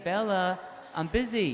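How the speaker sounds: hammed-up exasperation at the bell; exasperated. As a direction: lose patience with the dog. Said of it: "she'll get a little bit stubborn".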